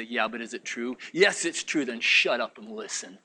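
low, soft inner dialogue; hushed inner voice. Retold or imitated. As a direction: answer yourself low and quietly. Acts quiet inner voice answering.

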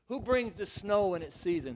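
ordinary talk, nothing marked

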